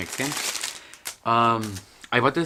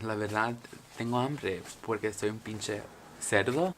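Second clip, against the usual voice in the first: ordinary talk, nothing marked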